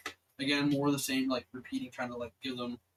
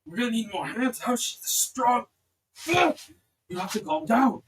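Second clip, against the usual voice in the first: louder, straining soldier voice; strained soldiers; 8 semitones higher, 7 dB louder than usual. Acted. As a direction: struggle to hold her down. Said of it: "they're like".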